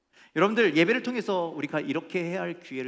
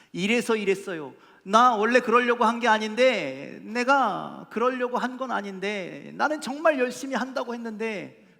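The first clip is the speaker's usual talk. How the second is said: high, loud, insistent self-justification; mock protesting excuses; 8 semitones higher, 9 dB louder than usual. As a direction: protest that you never meant it. Acts out people making excuses.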